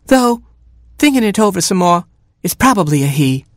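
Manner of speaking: high hen voice, easing down; Mama Hen. A narrator's reading. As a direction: start high, then settle into reconsidering. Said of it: "she clucked, flabbergasted".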